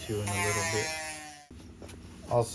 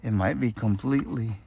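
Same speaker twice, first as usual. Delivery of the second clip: normal talking voice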